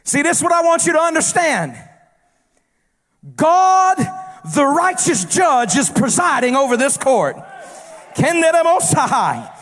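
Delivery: rising, shouted sermon cadence in a long crescendo; full-force preaching. Acted. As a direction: preach it hard and build the congregation up.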